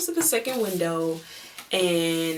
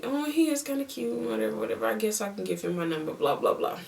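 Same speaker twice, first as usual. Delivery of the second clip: softly voiced inner musing; hushed talking-to-myself aside; inner thoughts; 3 semitones higher, 7 dB quieter than usual. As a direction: think it aloud, quietly, trailing into blahs. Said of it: "this is me in my head".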